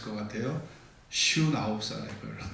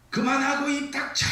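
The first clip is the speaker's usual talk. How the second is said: sudden high-pitched yell; explosive, furious outburst; angry shout; 12 semitones higher, 3 dB louder than usual. Acted. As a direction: act out a husband exploding at his wife. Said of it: "이때 남편이 버럭 소리를 질러요".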